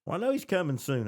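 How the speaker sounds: usual speaking voice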